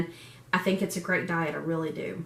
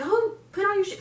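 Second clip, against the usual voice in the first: high, casual nagging voice; lightly mock a nagging onlooker; imitating others; 11 semitones higher, faster than usual. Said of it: "other people are like".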